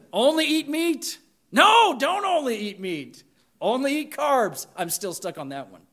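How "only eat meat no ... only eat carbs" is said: higher, louder sarcastic mimicry of fad rules; mock diet advice. Pastor mocking the history of dieting, sarcastic. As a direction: toss out each fad rule with exasperated sarcasm.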